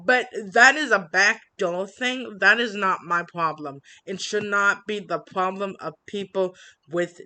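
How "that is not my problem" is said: ordinary talk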